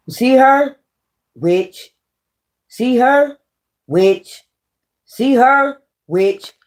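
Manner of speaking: sing-song calling out, high on see her; mock accusing, each witch named flat; pointing out witches.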